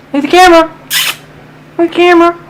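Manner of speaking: high, loud sing-song coax. Real, not acted. Owner coaxes her puppy in a high voice to look at the camera.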